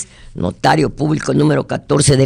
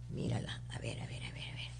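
breathy whispered aside, almost no voice; 4 semitones lower, 28 dB quieter, faster than usual. Real, not acted. Host on air whispering while hunting for candy. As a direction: drop to a secret whisper.

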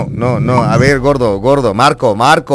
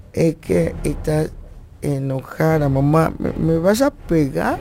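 a hint of a scared kid, softer and slower; timid child; 7 dB quieter, slower than usual. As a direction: plead softly like a child about to be punished. Child pleading with angry mother.

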